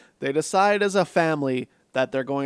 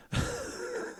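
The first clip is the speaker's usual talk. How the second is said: breathy laugh; 12 dB quieter than usual. Real, not acted. Laughs at the movie.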